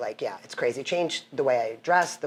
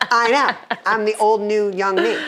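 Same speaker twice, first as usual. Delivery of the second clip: higher, louder smug self-praise; mock boastful; 7 semitones higher, 6 dB louder, slower than usual. Answering the neighbor's compliment, playfully smug. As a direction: brag playfully. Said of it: "It's like".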